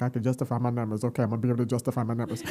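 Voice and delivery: fast, low, soft run of words; rushed self-talk. Retold or imitated. Repeating her daughter's advice to herself, rushed low mumble. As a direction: rattle it off quietly to convince yourself. Acts out anxiously reassuring herself.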